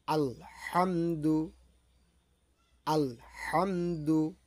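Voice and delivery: Alhamdu said slowly, each syllable drawn out; measured, separated syllables of Alhamdu; careful recitation of Alhamdu. Shown as a demonstration. Teacher modelling the word for learners, slow careful Alhamdu. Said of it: "আমরা সূরা ফাতিহা তেলাওয়াত করব".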